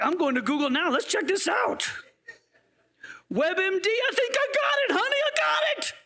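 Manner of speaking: panicky googler. Retold or imitated. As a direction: play a worried reader of WebMD. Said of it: "Some of you are like".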